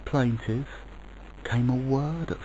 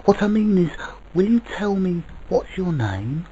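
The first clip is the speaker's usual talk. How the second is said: put-on high, loud child voice, pressing; excited, frightened child; 9 semitones higher, 5 dB louder than usual. A narrator's reading. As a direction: blurt it out as an eager, nervous child. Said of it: "both excited and quite frightened".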